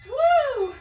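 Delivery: loud, high whoop of relief; excited whoop. Real, not acted. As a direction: cheer as the set ends. Cheering end of set.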